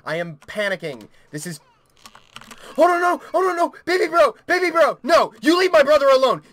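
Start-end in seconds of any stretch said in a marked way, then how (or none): 2.8-6.4 high, loud, frantic threats; desperate protective fury at full volume; panicked screaming. Real, not acted. Screaming to protect baby brother in game.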